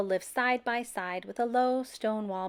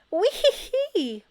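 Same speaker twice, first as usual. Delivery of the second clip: high, slow squeal; 11 semitones higher, 8 dB louder, slower than usual. A narrator's reading. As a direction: let out a long squeal. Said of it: "Beatrice heard Abel squeal".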